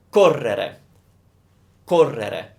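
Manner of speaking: Correre with the first syllable co stressed. Shown as a demonstration. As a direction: punch co in Correre. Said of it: "the stress is on the co".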